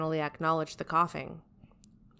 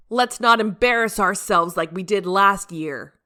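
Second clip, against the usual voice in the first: warning tone; 3 semitones higher, 10 dB louder than usual. Acted. Captain warning the team before the party, loud warning. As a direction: warn them, voice raised and firm.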